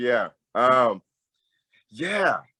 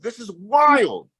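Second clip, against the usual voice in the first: amazed exclamation; 8 semitones higher, much slower than usual. Retold or imitated. Amazed at Dutch man's reaction.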